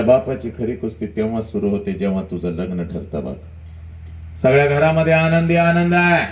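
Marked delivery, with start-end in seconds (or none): 4.4-6.2 joyful telling. Acted. Wedding joy described.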